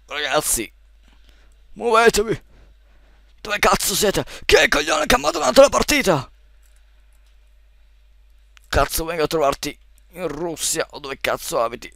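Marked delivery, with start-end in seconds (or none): 1.7-11.9 urla acute e rabbiose; furioso. Real, not acted. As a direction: sfoga la rabbia sui compagni. Furioso con i compagni. (sfogo furioso)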